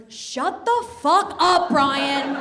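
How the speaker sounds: high, loud, slow yell; exasperated yell. Retold or imitated. Retelling her reply to a partner in bed, loud and high. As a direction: snap it at him high and loud. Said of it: "I was like".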